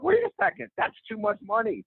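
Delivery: mock panic at the money; retold inner alarm. Man recalling his own thoughts, a high startled inner voice.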